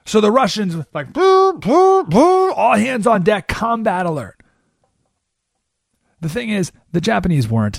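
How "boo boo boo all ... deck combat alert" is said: high, loud mock alarm shout; mock alarm call. Russian sailors raising battle alarm, hammed-up shout. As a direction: cry out like a ship's alarm is sounding.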